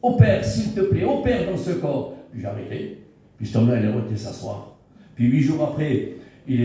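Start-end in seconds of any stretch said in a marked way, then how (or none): 0.0-2.1 retold fervent prayer. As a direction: pray it out, asking God to operate. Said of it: "C'était ma prière".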